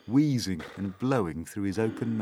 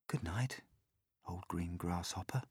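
much softer sleepy good night; whispered good night; 10 dB quieter than usual. A narrator's reading. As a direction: breathe it to the grasshopper. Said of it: "he whispered".

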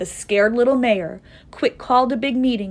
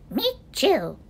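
put-on small, high child-like voice; young kangaroo; 8 semitones higher than usual. A narrator's reading. Young kangaroo backs up its mother, small high voice. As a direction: pipe up from the pouch.

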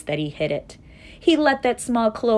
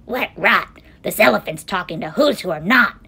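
loud, scornful monkey brothers; shouting; 7 dB louder than usual. A narrator's reading. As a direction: shout them down indignantly. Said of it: "came shouting".

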